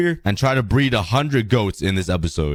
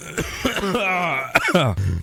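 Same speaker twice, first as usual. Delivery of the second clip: long sick hacking cough; 6 semitones higher than usual. Real, not acted. Sick gamer coughing fit mid-video, a long hacking cough. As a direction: cough it out hard and hoarse. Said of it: "I'm dying".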